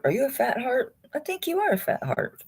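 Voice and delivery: put-on high, soft baby talk to a pendant. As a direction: coo to the heart like a pet. Cooing to a pendant.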